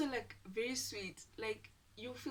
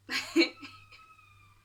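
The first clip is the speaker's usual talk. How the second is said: loud high-pitched burst of giggling; high giggle; 11 dB louder than usual. Real, not acted. Storyteller to viewers before quoting him, high giggle.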